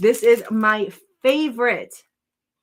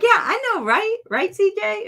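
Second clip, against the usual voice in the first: much higher, squealy playful voice; mock excited reply; 12 semitones higher, 4 dB louder than usual. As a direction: gush back at a viewer in a high voice. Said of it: "I saw your name and I'm like".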